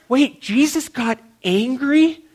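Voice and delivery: high, drawn-out mock astonishment; shocked disbelief. Churchgoer learning of Jesus's temper, shocked disbelief. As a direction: question it slowly, high and incredulous. Acting out a shocked believer's reaction.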